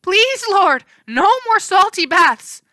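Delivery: high, loud pleading horse wail. A narrator's reading. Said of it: "the horses wailed".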